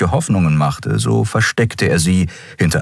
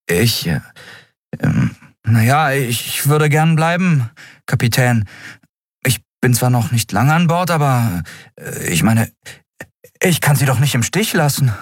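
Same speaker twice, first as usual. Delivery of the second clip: put-on high, halting young crew voice; Miko, hesitant; 7 semitones higher than usual. Crew member telling the captain he will stay, halting. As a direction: stumble shyly towards a loyal answer, pitched up.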